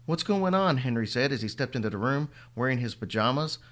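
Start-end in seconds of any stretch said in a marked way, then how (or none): 0.1-0.8 alarmed. Acted. Sleepy boy hears screaming.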